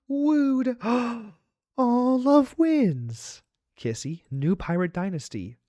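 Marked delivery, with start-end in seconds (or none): 0.1-3.4 high, loud, gasping delight; delighted gasps. Real, not acted. Streamer swooning at the pirate romance, high and gasping. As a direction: swoon over the love story.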